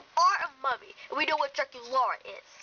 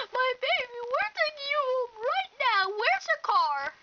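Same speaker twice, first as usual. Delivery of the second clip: high-pitched, anxious insistence; overprotective, high-voiced alarm; urgent fussing mother; 8 semitones higher than usual. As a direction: fuss over your child in a high voice. Mother wants to rescue daughter.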